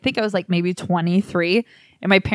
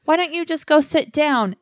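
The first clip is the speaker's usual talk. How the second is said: high mom voice; 8 semitones higher than usual. Retold or imitated. Mom offers to clean up.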